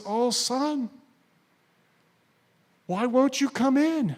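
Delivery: high, gentle fatherly plea; a father's high, tender call to his son; coaxing father. Acted. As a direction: coax him in like a loving dad.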